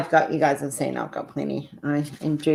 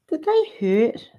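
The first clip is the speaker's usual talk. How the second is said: put-on teen girl; 10 semitones higher, 4 dB louder, slower than usual. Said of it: "she goes".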